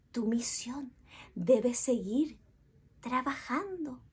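soft secret whisper. Acted. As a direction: whisper it close. Queen whispers advice.

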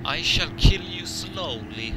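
slow, drawn-out mock villain threat; menacing threat. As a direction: threaten slowly like a movie mobster.

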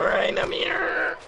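slow, high mock shout. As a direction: parody the callout with a shriek. Teammate mocks the callout.

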